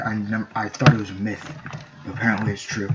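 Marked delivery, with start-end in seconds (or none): none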